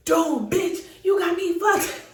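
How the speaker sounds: high mock furious retort; mock angry man. Her baby daddy yelling at her over the car, mock angry. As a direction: throw his anger back. Him pushing her, mad.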